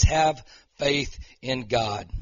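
each word slow and weighty; solemn slow scripture line; slow emphatic reading. Acted. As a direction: lay down the words slowly, like scripture. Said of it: "Jesus says".

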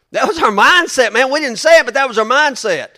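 high, loud preaching peak; fervent preacher's cry; preaching emphasis. Real, not acted. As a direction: drive the point home. Preaching at full force.

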